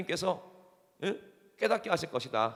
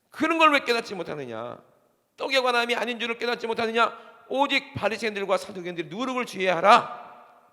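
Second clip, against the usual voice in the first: preaching Jesus's rebuke; 7 semitones higher, 9 dB louder than usual. Acted. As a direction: thunder the scripture at the congregation. Preaching scripture quote.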